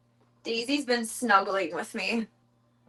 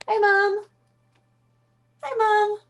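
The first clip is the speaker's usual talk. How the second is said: high sing-song hi to the cat, louder; pet talk; 11 semitones higher, 5 dB louder, slower than usual. Real, not acted. Greeting her cat in high pet-talk voice.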